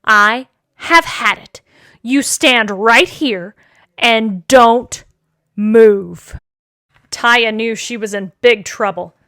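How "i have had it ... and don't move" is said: slow, low stern order; fed-up father. Acted. Father ordering Taya to stand still, fed up. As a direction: lay down the law word by word, slowly.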